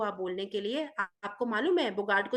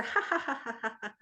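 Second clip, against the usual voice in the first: spoken ha ha ha, mock laughing; acted laughter. Harry laughs at the shrinking Bogart, mock laughing. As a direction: laugh out the ha's in a playful mock laugh. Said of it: "फिर वो और जोर से हंसा".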